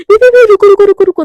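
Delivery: loud, high cries of stop; urgent high shout. Acted. Priyanka stopping naked Garima from opening the door, urgent shouting. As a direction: yell to stop her in a panic.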